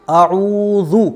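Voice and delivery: आऊज़ु with short opening a, very long ऊ; short hamza. Shown as a demonstration. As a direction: clip the first vowel of आऊज़ु, stretch the second. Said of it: "हमज़ा बिल्कुल भी नहीं खींचेगा". (आऊज़ु में हमज़ा छोटा, ऊ खींचकर)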